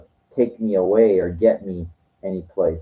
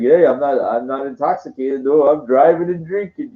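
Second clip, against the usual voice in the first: higher, louder, carefree tipsy driver brushing off the cops; mock drunk driver; 6 semitones higher, 5 dB louder than usual. Mocking a Texas driver who drinks at the wheel, hammed-up tipsy voice. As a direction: ham up a happy drinker who insists he's fine.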